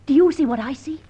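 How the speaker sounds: urgently pointing out to her partner; excited. Acted.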